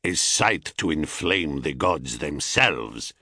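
low, seductive Thracian stranger; deep Thracian-accented wooing. A narrator's reading. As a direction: flatter her deeply in a thick Thracian accent. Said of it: "in a thick Thracian accent".

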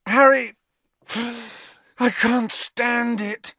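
high, drawn-out anguish; loud name, then slow high despair; desperate outburst. A narrator's reading. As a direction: groan it out slowly, at the end of your tether. Ron's desperate outburst to friend.

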